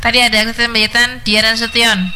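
normal talking voice

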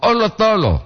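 "oh, lo tolo" pitched high, falling at the end; intonation demo. Shown as a demonstration. Host showing listeners the intonation of a phrase, high-pitched demonstration. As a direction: lift "oh, lo tolo" high, then let it drop. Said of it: "Po dungah intonasi".

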